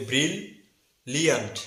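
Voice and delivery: ব্রিল, pause, লিয়ান্ট; syllable-split pronunciation. Shown as a demonstration. Teacher joining two syllables for learners, split bril-liant. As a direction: separate ব্রিল and লিয়ান্ট, L on each. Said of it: "দুইটি মিলে সঠিক উচ্চারণ হবে".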